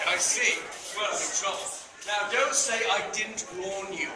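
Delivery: ordinary delivery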